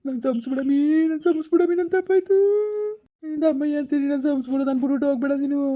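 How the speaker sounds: high-pitched pleading wail; desperate begging. Acted. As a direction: beg for forgiveness, voice climbing high. Husband begs her not to leave.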